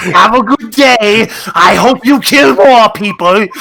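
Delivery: slow, sing out a cheery goodbye in jest; [name] seeing someone off. Pretend. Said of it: "wave him on and go".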